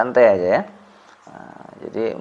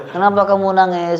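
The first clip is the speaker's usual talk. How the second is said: higher-pitched mock questioner; concerned questioner; 6 semitones higher than usual. Playing someone asking a weeping person, mock concern.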